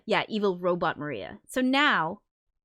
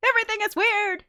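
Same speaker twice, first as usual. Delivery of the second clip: high, louder squeaky repeat of the phrase; 5 dB louder, faster than usual. Shown as a demonstration. Repeating her own line to herself in a high squeak. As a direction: pitch it way up, half laughing. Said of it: "repeated phrase, second take".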